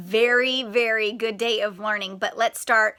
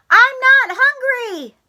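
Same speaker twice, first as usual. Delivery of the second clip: Froggy refusing; 13 semitones higher, 7 dB louder, slower than usual. Acted. Froggy voiced in story.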